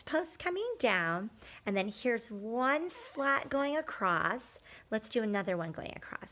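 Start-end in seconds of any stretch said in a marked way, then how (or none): 5.7-6.3 fast whispered aside. Real, not acted. Teacher whispers while drawing the fence slats.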